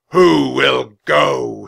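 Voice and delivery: slow, thunderous challenge to the whole hall; huge, drawn-out knightly call; booming. A narrator's reading. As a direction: boom each word out long and loud. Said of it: "he boomed".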